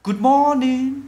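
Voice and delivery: "Good morning" high, with the d of "Good" marked; final d sounded. Shown as a demonstration. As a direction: model "Good morning" for learners. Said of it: "Âm đ, đ".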